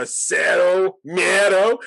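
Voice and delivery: Cero Miedo drawn out slowly in Spanish; slow Spanish catchphrase. Retold or imitated. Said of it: "I gave her a".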